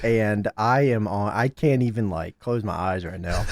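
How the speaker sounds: migraine-weary murmur. Real, not acted. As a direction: talk low through a migraine. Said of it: "I had a migraine".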